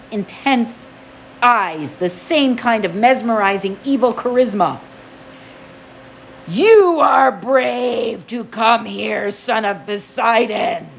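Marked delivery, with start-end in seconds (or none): 6.5-10.9 slow, oily menacing god. Acted. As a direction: purr it like a silken threat. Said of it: "he said in an oily voice".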